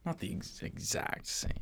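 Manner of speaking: whispered correction. Real, not acted. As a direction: mutter it unvoiced. Muttered correction.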